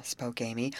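ordinary delivery

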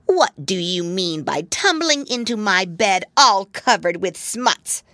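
an annoyed rat, teeth chattering. Acted. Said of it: "chattering his teeth".

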